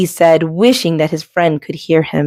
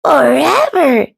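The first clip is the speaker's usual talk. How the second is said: happily; 7 semitones higher, slower than usual. Acted. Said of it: "said Duck happily".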